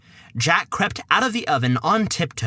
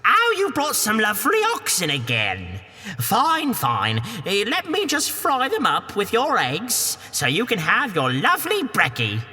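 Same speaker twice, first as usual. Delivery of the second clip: put-on high Cockney woman shooing the boy; a high, brash Cockney housewife; Cockney ogre's wife; 4 semitones higher than usual. Wife shoos Jack from the castle door, high Cockney.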